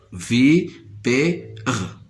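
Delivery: "V P R" as vi, pè, re, each apart; split into syllables. Shown as a demonstration. Teacher showing three syllables, "V P R" sounded out one by one. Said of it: "se compose de trois syllabes".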